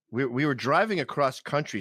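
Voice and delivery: ordinary delivery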